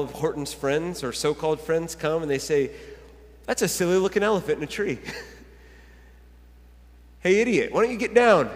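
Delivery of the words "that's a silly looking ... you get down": loud, higher-pitched heckle; mocking taunt. Horton's so-called friends heckle him in the tree, mocking.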